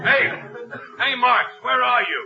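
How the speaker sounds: shouted high, searching in the dark; calling out loud. Acted. Kid yelling for his missing pal during the lightning, loud call. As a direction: yell for Marks across the hill.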